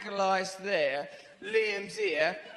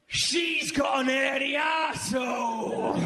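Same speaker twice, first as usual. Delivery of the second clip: loud, higher, blunt Mancunian blurt; 5 semitones higher, 5 dB louder than usual. Retold or imitated. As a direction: blurt it out like a gruff rock star. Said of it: "And [name] goes".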